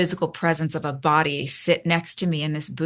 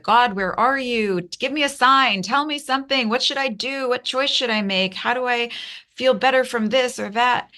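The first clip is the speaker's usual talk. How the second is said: pleading prayer; 6 semitones higher than usual. Retold or imitated. Anguished prayer on her knees.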